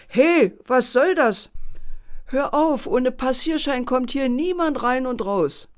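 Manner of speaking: laut und etwas höher, mit langsam gedehntem Anfang; barsch rufen. Acted. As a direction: den Bären durchs Fenster anschnauzen. Pförtner reißt Fenster auf, schimpft. (Pförtner ruft den Bären am Tor laut und barsch an)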